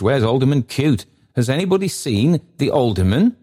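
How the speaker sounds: high-pitched, flustered questioning; great agitation. A narrator's reading. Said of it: "in great agitation".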